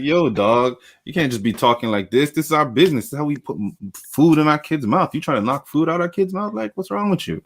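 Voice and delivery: high, hammed-up street voice; mock tough talk. [name] lecturing [name], hammed-up high mock street voice. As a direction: play it up like a fake tough guy. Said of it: "He came like".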